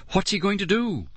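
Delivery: high frightened cry; fearful high question. A narrator's reading. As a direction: cry out scared. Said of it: "Lauren cried out in fear".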